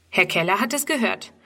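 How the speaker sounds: usual speaking voice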